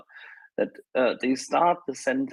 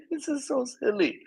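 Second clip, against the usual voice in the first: laughing; 11 semitones higher than usual. Real, not acted. Laughing at absurd bureaucratic statement.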